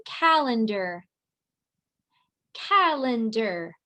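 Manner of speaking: calendar said slowly and clearly; slow clear model word. Shown as a demonstration. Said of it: "Say the word for me".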